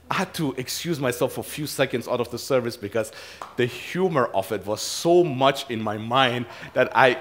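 normal talking voice

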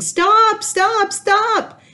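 very high, loud repeated shout; high urgent father's yell. A narrator's reading. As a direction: shout to stop the kids. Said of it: "Sierra's father ran along the river yelling".

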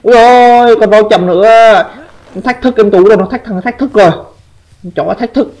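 high-pitched, worked-up outburst; real indignant exclamation. Real, not acted. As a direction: cry out high at being provoked. Kêu lên khi bị đối thủ thách thức. (kêu the thé vì bị thách thức)

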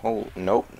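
ordinary delivery

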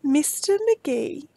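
ordinary delivery, nothing marked

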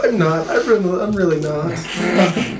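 high, giggly admission; amused confession. Real, not acted. Player admitting they aren't sorry at all, high and giggly. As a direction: own up through rising laughter. Admits laughingly to fake apology.

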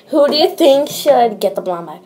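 normal talking voice

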